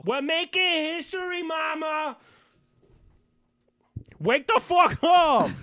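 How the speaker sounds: high, drawn-out hammed-up yell; mock rallying holler. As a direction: holler a mock wake-up call. Hollering in imitation of rousing caller.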